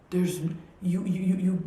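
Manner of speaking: normal talking voice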